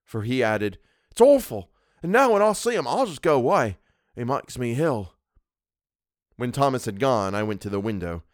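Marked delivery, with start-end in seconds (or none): 1.1-5.1 appalled Cockney, starting loud and high, easing down; Cockney dialect. A narrator's reading. Servant telling his employer of his loathing, in appalled Cockney. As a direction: recoil as a sickened Cockney. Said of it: "Thomas's Cockney dialect".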